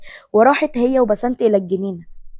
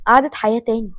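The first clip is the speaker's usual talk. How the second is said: ordinary delivery, nothing marked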